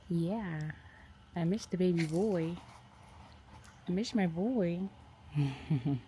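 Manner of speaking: soft, slow doting coo to the dog. Real, not acted. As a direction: fuss over the dog tenderly. Cooing to the dog.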